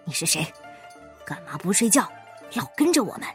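low, threatening interrogation; understated vicious challenge; menacing. A narrator's reading. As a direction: growl the questions with quiet menace. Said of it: "恶狠狠地说".

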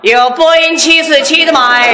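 slow, drawn-out hawker's call; street hawker's cry. Pretend. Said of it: "走街串巷得这么说了".

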